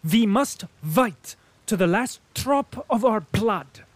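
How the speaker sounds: high, loud, slow vow; fervent German colonel. A narrator's reading. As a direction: declare it while thumping the table. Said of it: "thumping the table".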